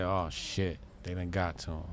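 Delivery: low, soft, deflated mutter; a man who just realised it reached him; muttered retold dismay. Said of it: "And now he said".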